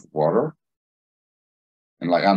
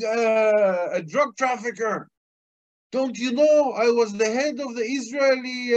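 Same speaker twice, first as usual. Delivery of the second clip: screaming; 4 dB louder than usual. Retold or imitated. Cop screaming at his doctor on the phone, furious high yelling. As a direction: yell accusations down the phone. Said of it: "a screaming conversation".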